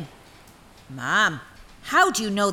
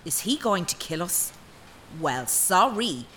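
usual speaking voice

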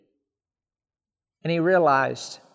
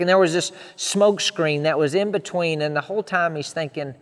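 ordinary talk

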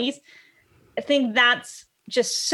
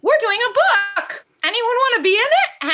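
higher and louder inviting shout; cheerful call-out; 11 semitones higher, 4 dB louder than usual. Retold or imitated. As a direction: call out to the room, upbeat. Friend calling out to dog owners.

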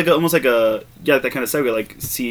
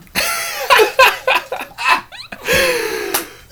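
high laugh. Real, not acted. Laughing at own impression.

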